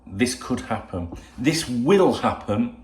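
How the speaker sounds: could then will said slowly, will stressed; slow, deliberate warning; slow emphatic. Real, not acted. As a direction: press each word slowly. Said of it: "I wanna make it very, very clear".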